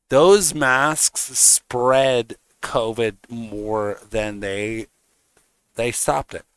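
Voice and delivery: slow, deliberate statement; simple, calm truth. Shown as a demonstration. Stating his view on masks slowly and calmly. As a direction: lay out each word slowly, as a calm fact. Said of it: "I'm gonna say it like that. Simple, calm".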